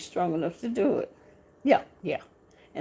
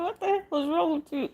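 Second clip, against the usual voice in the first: amused quote; 8 semitones higher than usual. Retold or imitated. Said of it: "He said".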